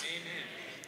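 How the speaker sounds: ordinary talk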